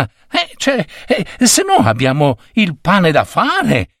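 put-on high, excited young voice; eager Bob. Bob promising his boss revenge, high and eager. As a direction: jump at the job, flustered.

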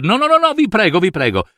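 high, rapid-fire refusal of thanks; flustered protest. Acted. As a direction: cut him off, pleading and embarrassed. Scrooge interrompe i ringraziamenti. (protesta concitata, acuta)

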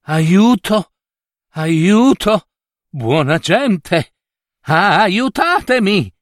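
wounded prince calls out into the woods; calling for help. A narrator's reading. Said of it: "a chiamare soccorso".

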